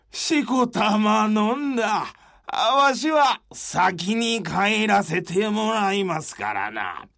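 put-on slurring, hammy drunk bravado; drunk old samurai. Drunk Serizawa refuses sake.

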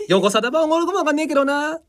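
grandpa teasing in Tsugaru dialect; high, rustic Tsugaru grandfather retort. Retold or imitated. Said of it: "その時おじいちゃまに".

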